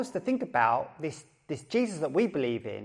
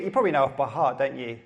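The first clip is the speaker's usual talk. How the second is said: ordinary talk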